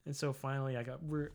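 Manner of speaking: usual speaking voice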